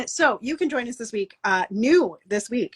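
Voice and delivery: ordinary delivery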